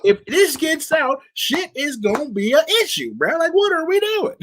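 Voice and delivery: high, exasperated rant. Real, not acted. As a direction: pitch it up in disbelief. Ranting about danger.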